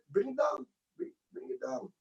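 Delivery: quiet breathy whisper of the neighbors; whispered plea to turn it down; hushed neighbors. Retold or imitated. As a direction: hush it like a fed-up neighbor. Neighbors complaining about loud music.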